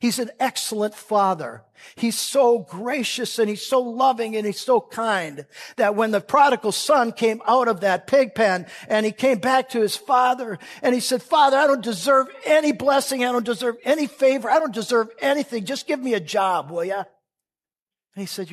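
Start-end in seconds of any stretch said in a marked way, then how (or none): 0.0-11.2 loud, high, fervent preaching; full-throated pulpit preaching; preaching at full force. Acted. 11.2-17.1 loud, high, pleading confession; the son cries out to his father, loud and pleading; begging prodigal son. Acted.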